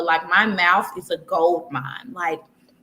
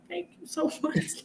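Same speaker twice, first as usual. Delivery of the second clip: laughing through tears; 8 semitones higher, 9 dB quieter than usual. Real, not acted. Thanking strangers while crying.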